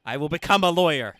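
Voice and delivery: loud, firm vow; determined vow. Acted. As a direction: declare it with full resolve. Dubbing determined game line.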